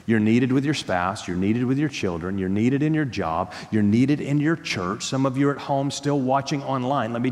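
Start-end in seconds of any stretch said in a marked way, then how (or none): none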